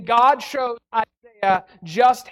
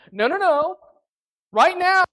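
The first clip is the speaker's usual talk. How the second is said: ordinary talk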